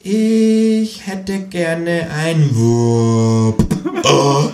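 very slow, stretched-out repeat; high kangaroo voice, extremely drawn out; as if for idiots. A narrator's reading. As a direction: drag out every word as if explaining to a dimwit. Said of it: "wiederholt das Känguru für Dumme".

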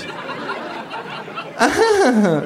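high mocking laugh; long high fake laugh; kangaroo laugh. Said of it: "lacht auch das Känguru".

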